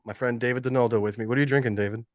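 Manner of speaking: ordinary talk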